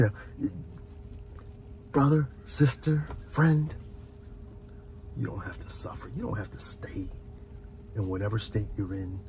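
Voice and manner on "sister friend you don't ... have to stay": ordinary talk, nothing marked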